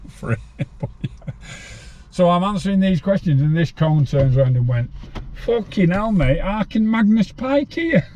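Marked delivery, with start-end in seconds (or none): 5.4-8.1 high, slow amazed exclamation; mock astonishment. Inmate gawping at a know-it-all quiz answerer, amazed and high. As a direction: pitch it up like a gobsmacked inmate.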